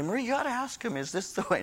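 high, softened joking voice; mock sheepish request. Husband asking his wife to get directions, mock sheepish. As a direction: pitch it up small for comic effect.

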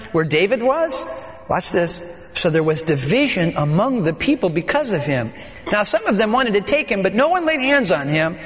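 solemn scripture reading. Acted. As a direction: read the passage steadily to the congregation. Reads the scripture passage aloud.

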